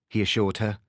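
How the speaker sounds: plain reading voice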